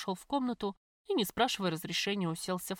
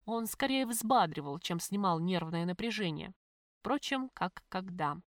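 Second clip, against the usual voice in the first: ordinary delivery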